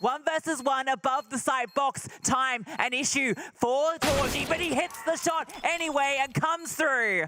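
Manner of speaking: fast live play-by-play call; esports caster. Acted. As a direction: call the action as it happens.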